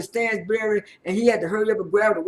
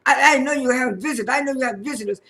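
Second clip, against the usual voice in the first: retold upset protest, higher pitch; mildly upset; 4 semitones higher, 4 dB louder, faster than usual. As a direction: imitate someone caught off guard and annoyed. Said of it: "Sergio was kinda upset".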